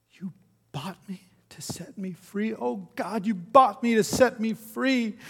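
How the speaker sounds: high, building to a loud cry; dawning wonder breaking into an anguished shout; overwhelmed disbelief. A narrator's reading. Freed woman falling to her knees, crying out overwhelmed. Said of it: "she fell down on her knees, sobbing".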